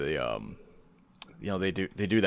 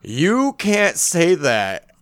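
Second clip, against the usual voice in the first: slow stunned; 5 semitones higher, 3 dB louder, much slower than usual. Retold or imitated. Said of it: "I was literally like".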